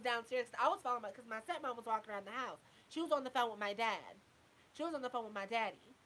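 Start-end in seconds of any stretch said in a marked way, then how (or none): none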